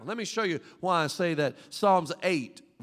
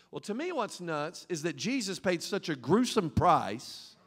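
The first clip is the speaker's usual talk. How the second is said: ordinary talk, nothing marked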